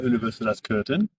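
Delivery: normal talking voice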